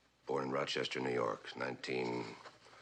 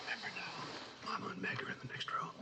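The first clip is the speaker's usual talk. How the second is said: hushed whisper; 5 dB quieter than usual. Acted. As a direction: whisper so nobody next door hears. Parents in the next room.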